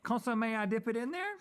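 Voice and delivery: consommé tried out high and wondering; high, hammed-up puzzlement. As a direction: sound out consommé in a high, unsure voice. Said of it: "learning the word".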